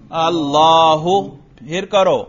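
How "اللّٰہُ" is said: "اللہ" ending in a clear, held -hu; رفع کا تلفظ. Shown as a demonstration. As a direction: say "اللہ" as Allaahu, slow and loud, with the u sounded. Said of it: "رفع پر تلفظ کر کے دکھاؤ". ("اللہ" کے آخر میں پیش، یعنی اللّاہُ)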